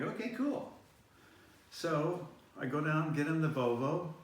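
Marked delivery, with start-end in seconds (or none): 0.0-0.7 much higher, breezy okay cool; mock casual agreement. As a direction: shrug it off lightly. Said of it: "I'm like".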